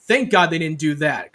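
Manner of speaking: ordinary talk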